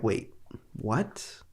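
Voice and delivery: quiet, let-down puzzlement; unamused confusion. Retold or imitated. Viewer reacting to a one-liner that falls flat, quietly baffled. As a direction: drop to a soft, baffled question. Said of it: "It's more just like, "No, like".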